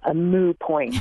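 "moo point" with no t after moo; "moo point" in place of moot point; sitcom character's mispronunciation. Retold or imitated. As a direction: say "moo" like a cow's call in "moo point". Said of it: "Joey talks about".

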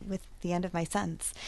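ordinary talk, nothing marked

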